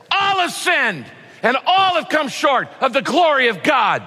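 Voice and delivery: hammered-out, accusing shout; angry shout. Shown as a demonstration. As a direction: hurl the verse at them in anger. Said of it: "I could shout it to you in anger".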